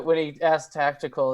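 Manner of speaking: ordinary talk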